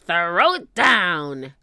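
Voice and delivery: slow, loud pirate command; drawn-out greedy holler; Captain Bones. A narrator's reading. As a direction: cry the order out slowly. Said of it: "cried Captain Bones".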